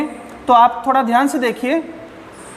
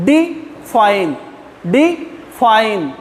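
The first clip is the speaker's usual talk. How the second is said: "Define" very slowly, split as डि, fine, more breath on fine; syllable stress demo; much slower than usual. Shown as a demonstration. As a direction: break "define" in two and lean on fine. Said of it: "सांसों को ज़्यादा जोर कहाँ देना पड़ रहा है".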